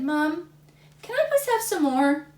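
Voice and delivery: put-on high, loud whiny kid voice; pleading little boy. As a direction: beg mom like a hungry boy. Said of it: "Then he said".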